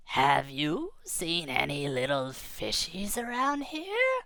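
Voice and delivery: slow, soft big-fish question. Acted. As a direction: drag it out like a lurking predator. Big fish asks disguised anchovies.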